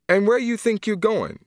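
an aunt stopping him at the door; sharp challenge. Acted. Aunt confronting Grant.

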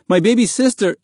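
urgent high plea, cut short; begging. A narrator's reading. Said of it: "Mahmoud begged".

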